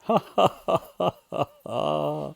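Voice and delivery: acted string of ha-ha laughs; hearty lion laughter. Lion roars with laughter instead of roaring, acted big laugh. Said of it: "with a laughter instead".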